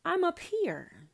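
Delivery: God shouting down at a distracted child; mock angry God. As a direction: play God annoyed that nobody is looking at him. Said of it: "he's, um".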